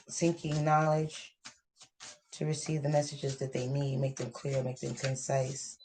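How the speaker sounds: soft, hushed prayer to Spirit; quiet prayer. Acted. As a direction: softly thank Spirit for the messages.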